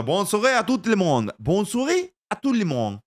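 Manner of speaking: put-on slow, showy French-accented bonsoir; mock French greeting. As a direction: greet everyone in proud bad French. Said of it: "cómo se me da el inglés".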